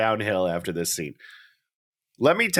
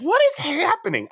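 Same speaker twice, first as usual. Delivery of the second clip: much higher, incredulous squeak of a question; baffled disbelief. Retold or imitated. Watching the bizarre Franco scene, a high baffled squeak. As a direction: act utterly bewildered by what you see. Retelling reaction to weird scene.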